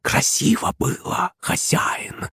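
hissing snake voice. Acted. Snake familiar speaks.

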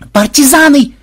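high, loud cry of alarm; dramatic yell. A narrator's reading. Programmer alarming German guards about partisans, dramatic yell. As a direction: scream a warning, overdramatically. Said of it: "драматично завопил Ксенобайт".

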